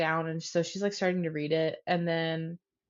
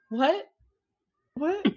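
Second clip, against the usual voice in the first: higher-pitched, halting questioning "what"; dumbfounded; 8 semitones higher than usual. Retold or imitated. Therapist reacting to the jail picture, dumbfounded. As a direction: imitate someone at a loss for words. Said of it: "had no response".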